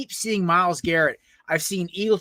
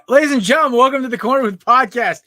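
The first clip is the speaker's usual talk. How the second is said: announcer; 7 semitones higher, 7 dB louder than usual. Acted. Host opens the show.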